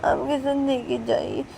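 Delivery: put-on high, slow, hurt little girl; child explaining and begging mummy; small girl voice. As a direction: talk like a small hurt daughter. Child voice held.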